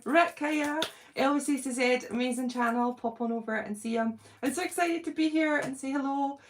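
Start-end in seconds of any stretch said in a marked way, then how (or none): none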